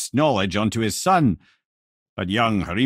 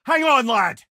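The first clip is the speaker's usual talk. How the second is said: urgent call; 12 semitones higher, 5 dB louder than usual. Acted. Calling out in danger.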